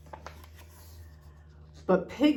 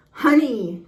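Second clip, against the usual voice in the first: much louder, a touch higher, savouring honey; loud announcement; 5 semitones higher, 9 dB louder than usual. A narrator's reading. Frankenpoo calling for honey, loud and pleased. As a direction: announce it because you like the sound. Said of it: "he announced again".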